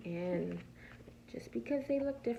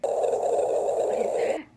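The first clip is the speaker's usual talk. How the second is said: scared stage whisper; 12 dB louder, much slower than usual. Acted. Pete scared by night noise.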